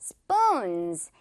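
"Spoons" with a tense unaspirated p like Korean 뿌; tensed p. Shown as a demonstration. Teacher shows the p sound for Korean learners, clear and deliberate. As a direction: say "Spoons" with no puff of air after the p. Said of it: "이렇게 뿌 발음으로".

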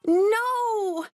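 high sharp cry of alarm; cried out. Acted. Millie cries out as the dog chases a cat, high alarm. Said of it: "cried Millie".

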